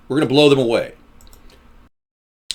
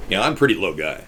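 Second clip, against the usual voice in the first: pitched lower, a bit softer; 6 semitones lower, 3 dB quieter, faster than usual. Shown as a demonstration. Streamer joking about being a low guy, low voice. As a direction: stay down in the low register. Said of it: "I can talk really low".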